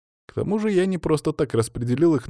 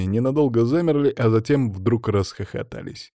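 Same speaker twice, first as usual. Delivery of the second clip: plain reading voice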